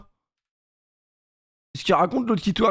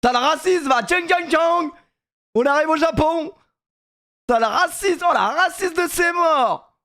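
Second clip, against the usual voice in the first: high-pitched hammed-up outcry; high, cartoonish mock indignation; mock outrage; 8 semitones higher than usual.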